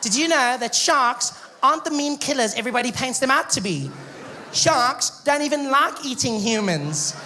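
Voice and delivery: high, earnest presenter put-on; shark expert. Shark expert defending sharks on TV, mock earnest. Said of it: "shark experts. And they come out".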